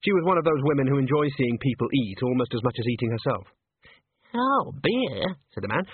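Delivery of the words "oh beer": high squeaky little man. A narrator's reading. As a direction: squeak with longing for a drink. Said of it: "in a squeaky voice".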